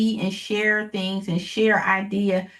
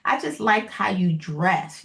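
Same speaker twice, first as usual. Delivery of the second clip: ordinary delivery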